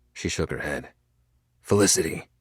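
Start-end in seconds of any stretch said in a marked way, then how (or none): none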